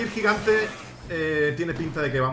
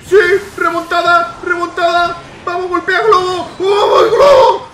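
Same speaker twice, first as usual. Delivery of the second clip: high shouts building to a scream; frenzied cheering; 13 semitones higher, 13 dB louder, slower than usual. Real, not acted. Player urging the balloon on, building ecstatic screams. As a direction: roar the team on, louder and higher.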